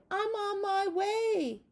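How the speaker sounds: very high, loud, slow farewell; high fading goodbye call; butterfly calling goodbye. Acted. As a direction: sing out goodbye as it flies away. Butterfly flying off.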